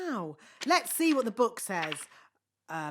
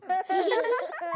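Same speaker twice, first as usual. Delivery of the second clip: nervous laugh; 7 semitones higher than usual. Acted. Nervous laugh after blunder.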